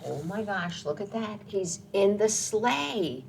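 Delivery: plain reading voice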